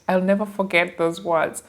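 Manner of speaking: normal talking voice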